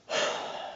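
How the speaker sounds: soft, voiceless sigh; regular sigh. Shown as a demonstration. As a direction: breathe out a plain, quiet sigh. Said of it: "regular sigh".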